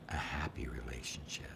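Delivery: whispered intention. Shown as a demonstration. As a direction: breathe it into the glass.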